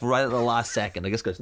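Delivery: ordinary delivery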